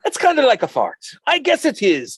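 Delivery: high-pitched, wobbly Irish brogue; bad Irish accent. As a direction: put on a not-very-good Irish lilt. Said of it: "not doing a very good Irish accent right there".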